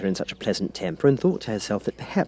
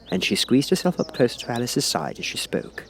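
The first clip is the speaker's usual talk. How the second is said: ordinary delivery, nothing marked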